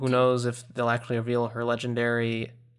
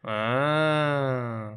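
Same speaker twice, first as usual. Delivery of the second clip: zombie moan. Acted. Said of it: "Here, I'll do it".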